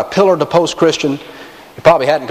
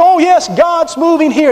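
high, gushing "Oh, yes"; mock excitement; 12 semitones higher than usual. Pastor mocking a church-hopper's excitement, much higher. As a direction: lampoon the thrilled church-hopper.

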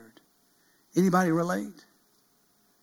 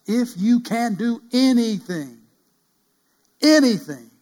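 high loud pleading, slow; urgent begging cry; 7 semitones higher, 8 dB louder, slower than usual. Acted. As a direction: stretch the plea out loud and high. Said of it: "you get on your face before God and you say".

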